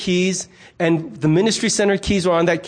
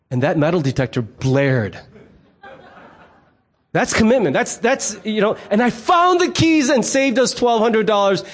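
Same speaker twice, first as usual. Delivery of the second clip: ordinary talk, nothing marked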